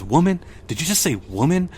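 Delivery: read-aloud offended comeback; indignant retort. Retold or imitated. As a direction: throw the word back, affronted, pitch jumping up. Reads teacher's indignant reply.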